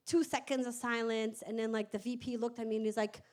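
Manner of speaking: normal talking voice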